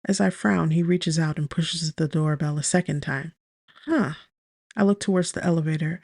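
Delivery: usual speaking voice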